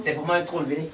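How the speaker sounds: usual speaking voice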